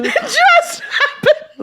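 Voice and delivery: high, stretched squeal of laughter; real laughing fit; helpless laughter. Real, not acted. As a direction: wail it out while laughing. Still cracking up at story.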